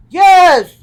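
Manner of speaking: high loud eager yes; eager Woodsman. A narrator's reading. Woodsman agreeing to return, high and loud. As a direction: blurt it out full of hope. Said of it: "said the Woodsman".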